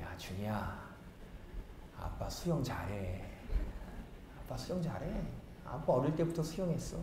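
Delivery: put-on hushed, calm reply to a worried child; quiet reassuring dad. Dad hushing his scared son in the wave pool, quiet and calm. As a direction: softly insist you can swim.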